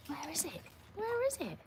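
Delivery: high, soft sing-out to a pet; dog-directed coaxing. Real, not acted. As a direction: coax the dog in a small high voice. Excited question to the dog.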